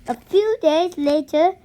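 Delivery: ordinary delivery, nothing marked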